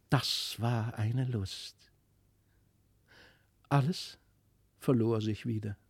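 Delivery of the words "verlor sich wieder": usual speaking voice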